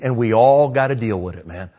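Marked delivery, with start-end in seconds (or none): none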